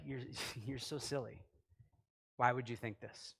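laughing it off. Pretend. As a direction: brush him off with a laugh. Laughing at Jesus, mock reply.

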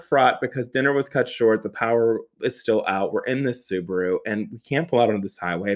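ordinary talk, nothing marked

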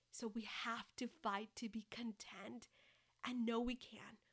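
slow, intimate whisper; whispered encouragement. Acted. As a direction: lean in and whisper the promise slowly. Intimate closing exhortation, whispered.

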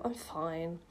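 low, soft reassurance; quiet brush-off. Acted. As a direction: murmur it low. Character brushes off help.

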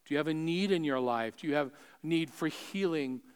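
soft, searching pastoral appeal; quiet altar call. Real, not acted. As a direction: ask softly, leaving room between questions.

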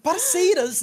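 high, loud, thrilled shout; excited shout. Real, not acted. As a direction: yell it out in delight. Excited shout over a reference.

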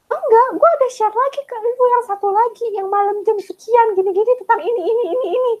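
high, whiny imitation of the friend; high mocking voice with a laugh at the end; mock insistent friend. Mimicking friend insisting loudly.

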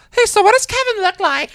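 high, put-on fangirl voice; mock admirer. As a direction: squeak it out as an eager admirer. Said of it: "a few people that ask me".

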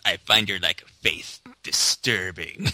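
low, slow menacing voice; slow and threatening like Vader. Retold or imitated. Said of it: "it just says".

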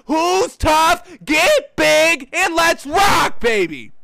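loud, high hyped yell, slowing down; hyped player. Retold or imitated. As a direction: shout like a pumped-up player.

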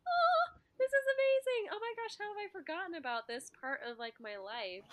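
high, bright gush of delight; gushing over a book she loves; excited gush. Retold or imitated. Rediscovering reading, gushing high with delight. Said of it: "I was like".

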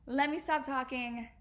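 normal talking voice

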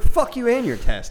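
high, loud, slow indignant shout; angry retort. Retold or imitated. Answering a fellow comic's text with indignant shouting. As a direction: bark it back, way up high.

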